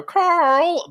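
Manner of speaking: high, loud cartoon llama call; imitated llama. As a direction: call the name out like a comic llama. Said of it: "the llamas go".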